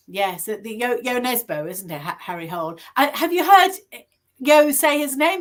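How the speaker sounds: ordinary talk, nothing marked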